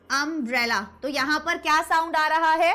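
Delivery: ordinary talk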